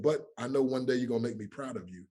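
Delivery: ordinary talk, nothing marked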